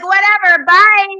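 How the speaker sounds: loud, high, careless whatever; dismissive brush-off. Retold or imitated. Family brushing off the policeman, loud and dismissive. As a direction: wave him off loudly. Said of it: "And they're like".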